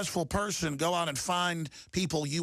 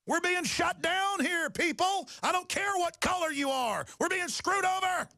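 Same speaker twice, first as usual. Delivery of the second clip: upset shout; 12 semitones higher than usual. Real, not acted. Radio host shouting at his listeners in anger. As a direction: yell it out in real anger. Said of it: "and I'm getting upset".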